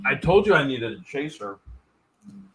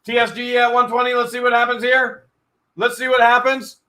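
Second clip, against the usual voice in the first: quick, raised auction calling; auctioneer calling bids; 6 semitones higher, 4 dB louder than usual. Real, not acted. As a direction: call out the bids like a live auction.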